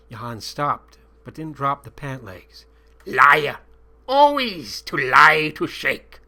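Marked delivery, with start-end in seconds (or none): 3.1-6.2 loud, slow accusing shout; accented angry guard. A narrator's reading. Guard Johann angrily calling the captive a liar. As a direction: shout the accusation in broken English.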